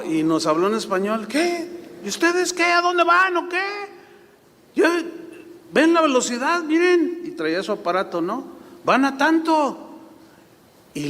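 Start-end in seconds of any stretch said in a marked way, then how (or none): 1.3-7.2 much higher, incredulous traffic cop; policeman. Retold or imitated. American policeman scolds pastors. 8.8-9.9 much higher, incredulous traffic cop; policeman. Retold or imitated. Cop reads the radar.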